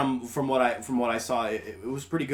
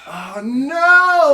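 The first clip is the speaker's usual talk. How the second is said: high, loud cry of dismay; 12 dB louder than usual. Real, not acted. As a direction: wail it in mock despair. Reacting to bad news about Danny Boyle.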